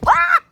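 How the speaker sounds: sudden high shriek of fright; startled scream. Real, not acted. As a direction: jolt out a loud startled yelp. Sudden startle while fixing camera.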